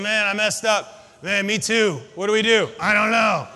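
two buddies stuck and panicking; two friends talking. Acted. Acted talk between two friends.

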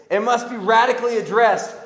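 loud, high, pounding on radically; forceful preaching. Real, not acted. Preacher drives the line home to the congregation, loud and high. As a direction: preach it so the room repeats it. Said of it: "Let me hear you say it".

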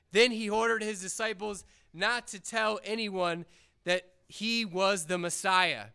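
normal talking voice

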